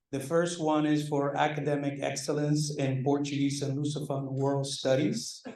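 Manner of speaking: normal talking voice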